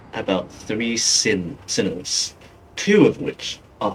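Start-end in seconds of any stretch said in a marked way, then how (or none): none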